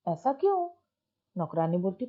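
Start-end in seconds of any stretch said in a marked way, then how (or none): 0.1-0.7 mother's puzzled question, pitched well higher; worried mother. Retold or imitated. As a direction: ask anxiously, higher voice. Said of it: "माँ पूछती".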